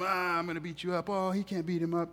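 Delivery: high jeering bully voice; mocking bullies. Preacher mimics bullies jeering at the kid. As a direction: taunt like a schoolyard bully. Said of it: "bullies are making fun of the one kid".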